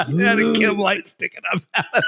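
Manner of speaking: high, laughter in the voice; real laughter about the prank; laughing. Real, not acted. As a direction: talk through giggles. Laughing at his own prank.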